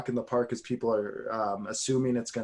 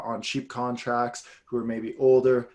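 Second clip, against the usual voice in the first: ordinary talk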